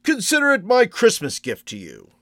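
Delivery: high, loud, twinkling delivery; merry old gift-giver, pitched high; jovial old shopkeeper. A narrator's reading. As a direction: offer it like a great joke. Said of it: "winked as if this was a great joke".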